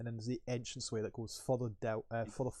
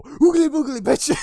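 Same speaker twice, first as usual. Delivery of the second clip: high, very loud taunting shout; hammed-up scare shout; mock scare yell; 20 dB louder than usual. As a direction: yell it to spook someone. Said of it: "and go".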